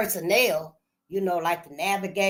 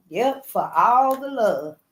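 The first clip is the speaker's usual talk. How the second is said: ordinary talk, nothing marked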